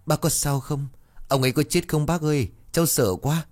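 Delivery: small scared voice asking for help; worried. A narrator's reading. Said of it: "nó lo lắng".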